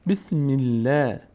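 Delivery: "بسم الله" slowly, thin L and fronted long "ae"; light L. Shown as a demonstration. («بسم الله» کشیده با لام باریک)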